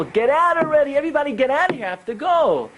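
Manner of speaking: high-pitched, exasperated mock shout; urgent storyteller's shout; shouting retold. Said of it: "He's, like, shouting at the people".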